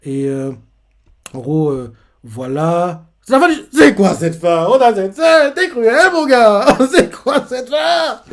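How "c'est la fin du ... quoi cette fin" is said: high, loud laughing protest at the cliffhanger; real outraged excitement. Real, not acted. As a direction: cry out at the cruel ending, laughing. Reacting to cliffhanger ending. (réaction indignée et rieuse, forte et aiguë)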